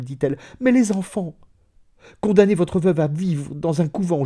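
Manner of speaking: high, loud, desperate pleading; supplication. A narrator's reading. Comtesse implores her dying husband. (supplication éperdue, voix haute et forte)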